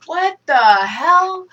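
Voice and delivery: drawn-out, high-pitched disbelief; retold bewildered outburst; incredulous reaction. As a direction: slowly stretch out the shock. Retold stunned reaction to the show.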